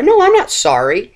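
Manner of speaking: loud, high, stubborn "No, I'm not sorry"; bristling mock defiance; defiant denial. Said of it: "You might reflect that on your face".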